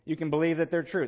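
ordinary talk, nothing marked

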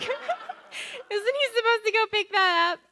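high, loud, slow question through laughter; laughing disbelief. Retold or imitated. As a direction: ask in mock alarm, barely holding back laughter. Retelling nervous question about dog.

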